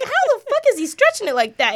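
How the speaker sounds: high, shocked disbelief; can't believe your eyes. Retold or imitated. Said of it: "I'm like".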